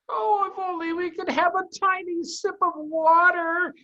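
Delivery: very high, loud little fox voice; small fox pleading. Acted. Starving cub begs in tunnel, high pleading. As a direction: beg for water like a thirsty cub. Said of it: "said one of the small foxes".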